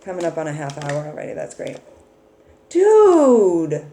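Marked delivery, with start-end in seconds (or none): 2.7-3.8 high, loud excited shout; thrilled exclamation. Real, not acted. Excited at the card.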